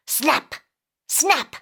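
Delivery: ordinary delivery, nothing marked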